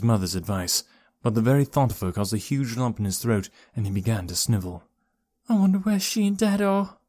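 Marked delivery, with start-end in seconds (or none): 5.5-7.0 put-on small, high, sad boy's voice; sad murmur. A narrator's reading. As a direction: wonder sadly, pitched high. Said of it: "he murmured sadly".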